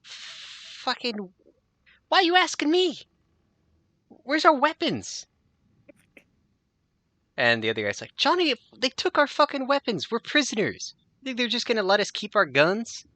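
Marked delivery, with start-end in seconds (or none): 0.0-5.3 high, louder, exasperated voice; annoyed prisoner. Acted. Prisoner snapping at his partner, high and exasperated. Partner snaps back at him. 8.2-13.0 high, louder, incredulous voice; exasperated prisoner. Acted. Ron scolding Johnny, high and fed up.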